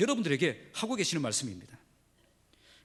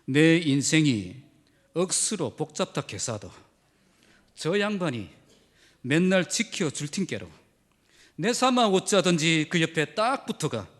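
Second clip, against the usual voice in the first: slow Gyeongsang dialect recitation; the pastor reads Psalm 23 in Gyeongsang dialect to his congregation; Gyeongsang dialect reading; 3 semitones lower than usual. Shown as a demonstration. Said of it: "경상도 사투리로 ... 읽어드리겠습니다".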